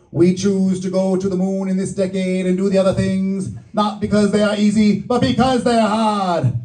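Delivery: put-on rousing Boston accent, building to the end; JFK Boston accent. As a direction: deliver it in a Boston accent like a famous moon speech. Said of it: "my Boston accent is really good".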